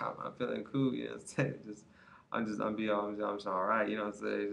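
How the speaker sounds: soft, lazy, spaced-out mumble; drowsy stoned murmur. Retold or imitated. Stoned coworker telling customers he feels cool, drowsy murmur. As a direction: sound half-asleep and mellow, barely above a murmur.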